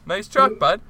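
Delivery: butter him up, playing dumb; fake friendly compliment. Telling the truck owner his truck is nice, in mock-innocent flattery. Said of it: "And I was like".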